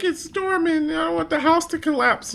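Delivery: put-on high worried little kid; child voice. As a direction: voice a nervous young son. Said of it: "my son was like".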